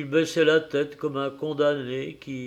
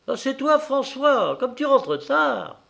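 a higher, loud homely greeting; mother's voice; 11 semitones higher, 5 dB louder than usual. A narrator's reading. Mother greeting her late husband over the stove, raised voice. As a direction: tease her husband without turning round. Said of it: "dit sans se déranger".

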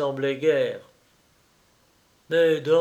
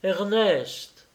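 higher, mildly panicked call; losing her head; 5 semitones higher than usual. A narrator's reading. Wife calling her unresponsive husband in bed, losing her head. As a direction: call his name with a touch of panic. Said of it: "Perdant la tête, elle l'appela".

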